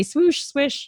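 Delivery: "Swoosh, swish" like the Nike swoosh; "Swoosh, swish", oo then short i; playful mispronunciation. Shown as a demonstration. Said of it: "like a Nike".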